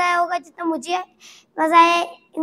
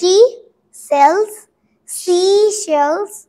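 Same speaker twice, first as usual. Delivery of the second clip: each word drawn out slowly; slow dictation; 2 semitones higher, 5 dB louder, much slower than usual. Shown as a demonstration. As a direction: spell it out slowly for the child. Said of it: "really fast".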